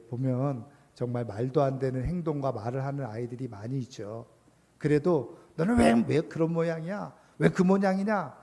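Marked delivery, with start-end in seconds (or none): 5.6-8.4 higher-pitched, exasperated mock scolding. Teacher to a misbehaving child, acted-out scolding. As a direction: act out a teacher telling a child off. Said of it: "이렇게 말하기보다".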